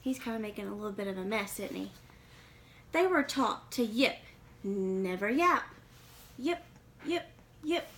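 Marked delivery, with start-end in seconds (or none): 2.9-7.9 put-on lively read-aloud for kids; storybook reading. A narrator's reading. Reading a picture book to young listeners, lively storytelling.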